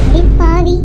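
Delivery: loud shocked cry; outraged shock. Acted. Elder sister shouts on learning it was all a plan. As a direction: blurt it out, caught off guard.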